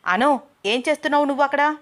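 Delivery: sudden high, loud and fast shout; loud yell. A narrator's reading. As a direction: bark it out, startling her. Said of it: "అని గట్టిగా అరిచాడు".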